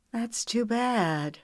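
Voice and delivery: high, slow, flirtatious purr. A narrator's reading. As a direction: draw it out as a coy purr. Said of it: "she purred".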